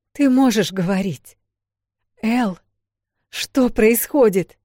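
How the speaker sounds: loud, high urgent questions; frantic. A narrator's reading. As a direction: demand answers in alarm. Sister frantic after waking.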